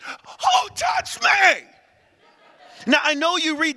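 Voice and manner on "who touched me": 1 shouting Jesus. Acted. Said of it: "and he said".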